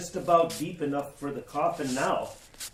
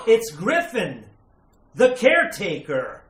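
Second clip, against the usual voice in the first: gasp, then a high, loud cry; horrified recognition; 5 semitones higher, 9 dB louder than usual. Acted. Man recognizes the caretaker's body in the coffin, horrified.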